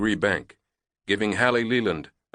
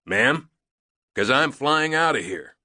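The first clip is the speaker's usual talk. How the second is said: shouting back; 7 semitones higher than usual. Acted. Loadmaster cheerful, shouting back.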